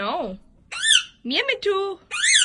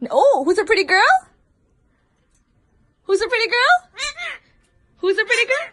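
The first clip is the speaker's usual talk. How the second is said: teaching a parrot; 5 semitones higher, 8 dB louder, much faster than usual. Real, not acted. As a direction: coo it to the parrot. Owner coaching pet parrot.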